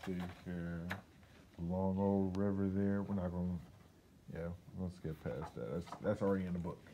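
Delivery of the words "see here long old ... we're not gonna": ordinary delivery, nothing marked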